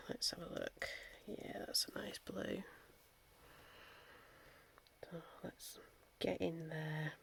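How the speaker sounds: very soft, half-whispered self-talk; absorbed murmur. Real, not acted. Crafter choosing a blue to paint with, absorbed murmur. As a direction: murmur it softly while busy with the paints.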